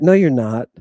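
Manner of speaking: usual speaking voice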